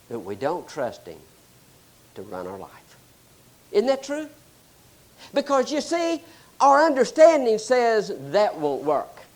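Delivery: ordinary delivery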